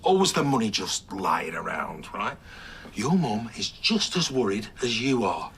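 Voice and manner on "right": ordinary talk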